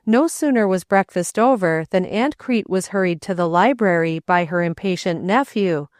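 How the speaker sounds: ordinary delivery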